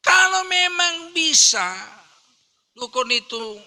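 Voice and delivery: loud, high-pitched sermon shout; full-force preaching. Real, not acted. Preacher ridicules shamans' powers to the congregation, full-force preaching.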